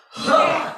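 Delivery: loud, high explosive huh; powerful huh. Shown as a demonstration. Leader ending the affirmation, explosive huh. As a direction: cap the chant with a burst of breath. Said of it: "our voices with a huh".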